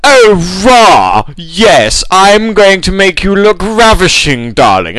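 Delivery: camp, drawn-out posh impression; mock upper-class drawl, high then dropping; effeminate toff. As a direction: gush slowly like a theatrical Chelsea toff. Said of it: "very effeminate fellow".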